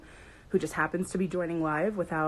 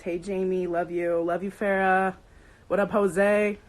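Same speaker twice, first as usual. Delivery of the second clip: usual speaking voice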